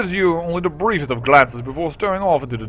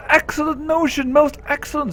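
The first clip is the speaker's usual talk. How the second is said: high, bright delight; 12 semitones higher than usual. Acted. Juliet thrilled by the letter plan, high delight. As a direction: squeal with delight. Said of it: "Juliet, eyes brighten".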